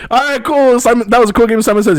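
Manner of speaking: announcer voice. Pretend. Pilot addressing passengers again.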